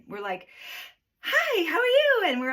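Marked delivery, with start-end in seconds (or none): 1.2-2.2 very high, loud, tense greeting; talking high. Retold or imitated. Anxious people greeting others while holding breath, talking high. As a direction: squeeze out an over-bright, breath-held hello. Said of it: "we talk fast and we talk high".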